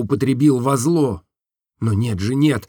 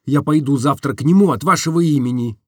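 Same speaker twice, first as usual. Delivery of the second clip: plain reading voice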